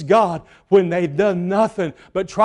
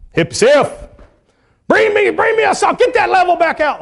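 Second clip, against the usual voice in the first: high, loud builder barking orders; bossy dad orders; 12 semitones higher, 4 dB louder than usual. Retold or imitated. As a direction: take over the job site. Father giving orders on site.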